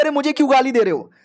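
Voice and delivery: high-pitched comic complaint; mock indignant protest. Cat objects to being sworn at, high mock-indignant protest.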